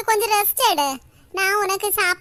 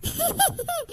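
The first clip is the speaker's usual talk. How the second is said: a quick high burst of laughter, then softer; short laugh; 7 semitones higher, 8 dB quieter than usual. Real, not acted. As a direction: laugh out at the flowery words. Amused by sister's grand words.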